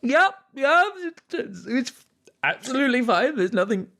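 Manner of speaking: high, strained voice pretending all is fine; a crying innkeeper insisting she's happy; Cora holding back tears. Cora tells the party she's fine, high and tearful. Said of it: "And she's like".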